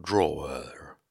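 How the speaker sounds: DRAWER overdone in two stretched syllables, "draw-er"; botched pronunciation. Shown as a demonstration. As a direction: stretch DRAWER out until it falls apart. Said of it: "You can't say it properly".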